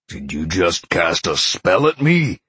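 loud, incredulous growl; angry growl. A narrator's reading. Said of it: "Sean growled".

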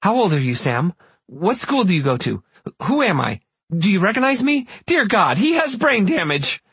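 climbing to high alarm; rattled-off questions. Acted. Mother questioning her son, rising to high alarm. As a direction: fire the questions off, panic rising. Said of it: "rattled off a series of questions".

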